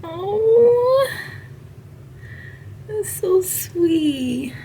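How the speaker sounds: high, slow, tearful voice; about to cry. Real, not acted. Nurse reading a thank-you card, tearful. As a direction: let the tears come through. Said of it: "I'm about to cry".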